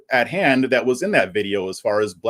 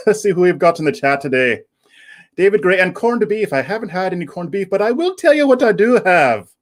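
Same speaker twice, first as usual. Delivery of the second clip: playful, exaggerated fake Irish lilt; fake Irish accent; 5 semitones higher than usual. Host shows off his pint of Guinness, fake Irish accent. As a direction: keep up a silly put-on Irish accent. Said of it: "fake this stupid accent".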